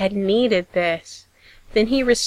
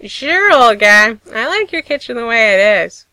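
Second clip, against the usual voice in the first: easy teasing; 2 semitones higher, 8 dB louder than usual. Acted. Dean teasing O'Neill from the door, easygoing and loud. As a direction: tease the older man fondly. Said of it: "easy, teasing".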